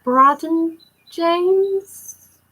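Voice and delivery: drawn out slowly and pitched high, searching memory; unsure question. Real, not acted. Fan checking her memory of the photo, slow high question. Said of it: "Yes, Brad and James".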